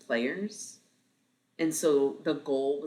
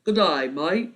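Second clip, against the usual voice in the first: loud, higher mock Australian accent; Henry; 4 semitones higher, 9 dB louder, slower than usual. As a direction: imitate an electronic game's Aussie greeting. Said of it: "I'm impersonating Henry".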